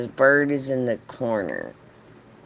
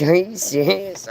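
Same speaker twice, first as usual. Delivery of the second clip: high-pitched imitated astonishment; mock disbelief; 7 semitones higher than usual. Bird's owners doubting the visitor, incredulous. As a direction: question it as if stunned.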